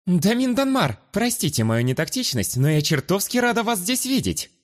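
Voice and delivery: high, eager, gushing greeting; gushing chatter. Acted. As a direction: barge in with bright enthusiasm. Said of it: "продолжала тараторить".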